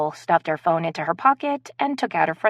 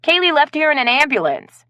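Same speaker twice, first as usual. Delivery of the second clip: higher, louder, stern; irritated louder rebuke; angry retort; 10 semitones higher, 7 dB louder than usual. Acted. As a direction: drive the point home. Blaze still snapping at friends.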